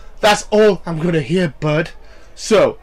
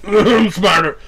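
higher, louder taunt; raised cocky brag; louder cocky boast; 7 semitones higher, 7 dB louder, slower than usual. Shown as a demonstration. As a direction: throw the boast up and out at him. Repeats line in several takes.

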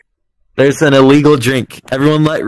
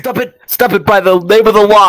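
cop voice; 6 semitones higher, 6 dB louder than usual. Pretend. Mock police command.

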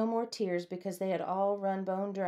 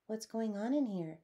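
innocent question; 3 semitones higher, 5 dB quieter than usual. A narrator's reading. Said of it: "innocently wanted to know".

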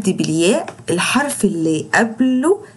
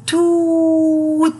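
very slow توت, higher, oo held; long drawn vowel; 7 semitones higher, much slower than usual. Shown as a demonstration. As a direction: stretch the oo in توت. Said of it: "شايفين بقي عمل ازاي".

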